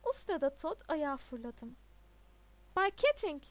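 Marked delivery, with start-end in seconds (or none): 2.8-3.4 loud, raised-pitch call; shouting. A narrator's reading. Pupil interrupts the reading to call his teacher, shouting. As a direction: shout the teacher's name across the room. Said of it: "diye bağırdı".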